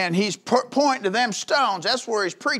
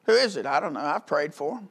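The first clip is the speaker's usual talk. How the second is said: usual speaking voice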